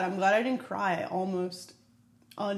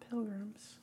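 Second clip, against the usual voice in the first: hushed spooky whisper; 13 dB quieter than usual. Pretend. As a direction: whisper it like a ghost story. Spooky whisper about pilgrims.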